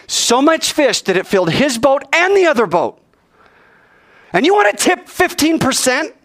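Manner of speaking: high, incredulous rebuke; indignant scolding. Real, not acted. Preacher shaming students who tip little, indignant scolding. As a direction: challenge them in rising disbelief.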